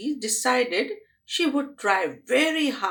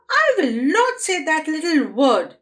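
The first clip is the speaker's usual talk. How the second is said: put-on loud, higher defiant girl; stubborn refusal; 7 semitones higher, 6 dB louder than usual. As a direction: refuse it like a sulky child.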